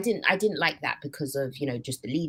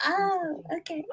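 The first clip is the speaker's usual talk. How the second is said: mock realisation; 13 semitones higher, slower than usual. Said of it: "I was just like".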